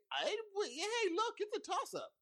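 very high, squeaky delivery; high mock-helpless voice; high-pitched waffling. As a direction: jump way up high as you weigh it. Acts out weighing two foes.

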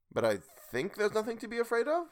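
statement lifting high into a question. A narrator's reading. Will unsure about the stranger, questioning tone. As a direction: end "afraid of" on a high questioning rise. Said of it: "There was a question in his tone".

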